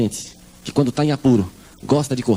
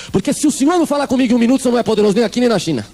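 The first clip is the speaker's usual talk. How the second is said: raised ultimatum; 8 semitones higher than usual. Retold or imitated. Mother's ultimatum to God.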